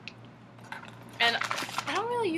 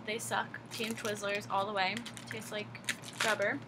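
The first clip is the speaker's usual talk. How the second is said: ordinary talk, nothing marked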